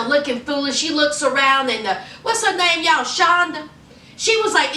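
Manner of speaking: ordinary talk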